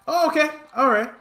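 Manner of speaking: high, baffled "oh okay"; surprised. Retold or imitated. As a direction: caught off guard, going along. His own baffled reaction, retold.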